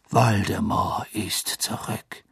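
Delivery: slow, whispered warning; grave whisper. Acted. Dumbledore quietly repeats the warning to the minister, whispered. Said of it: "wiederholte Dumbledore".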